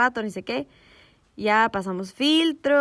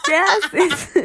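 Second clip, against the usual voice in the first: high, loud, laughing what-are-you-doing; laughing disbelief; 8 semitones higher, 6 dB louder than usual. Retold or imitated. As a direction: crack up at the stranger.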